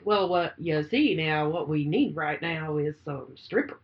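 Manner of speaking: low, slow, drawling rooster voice; a hammed-up Southern blowhard, drawn out; slow low boast like a cartoon rooster; Foghorn Leghorn impression. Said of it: "he talked like Foghorn Leghorn".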